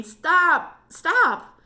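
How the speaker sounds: high, loud desperate cries; pained scream. Acted. Hurt Derek screams at Alex to stop moving the rock. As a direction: shriek at him to stop. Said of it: "screamed Derek in pain".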